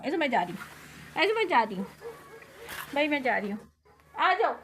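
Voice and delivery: usual speaking voice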